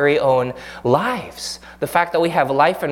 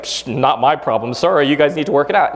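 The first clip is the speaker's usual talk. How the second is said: dismissive shrug. Retold or imitated. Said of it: "Jesus is just like".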